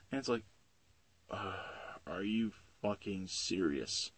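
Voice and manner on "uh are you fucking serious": slow, weary exasperation; groan, then drag out the question; exasperated disbelief. Acted. Hero losing his day off, slow exasperated groan. Superman's reaction to yet another call.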